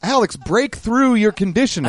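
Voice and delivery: high-pitched appeal; mock urging. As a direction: plead with him to snap out of it. Acts out urging Alex on.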